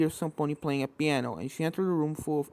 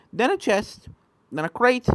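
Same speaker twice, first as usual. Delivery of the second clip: usual speaking voice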